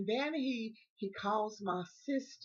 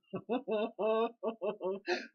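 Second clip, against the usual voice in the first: real gleeful giggle; helpless little run of giggles; giggling; 2 semitones higher than usual. Real, not acted. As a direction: chuckle with guilty delight. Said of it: "giggling like this".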